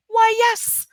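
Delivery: proud dragon. A narrator's reading. Dragon boasting to the princess, high and proud.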